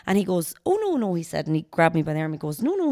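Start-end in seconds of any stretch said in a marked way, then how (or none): 0.7-1.1 high insisting. Retold or imitated. President insisting, voiced in story. 2.6-2.9 high insisting. Retold or imitated. President insisting, voiced in story.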